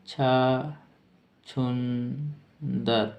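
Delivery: छुंदर stretched out into separated syllables; slow dictation. Shown as a demonstration. Teacher dictating the meaning of mole, very slowly. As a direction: draw छुंदर out very slowly with a gap inside.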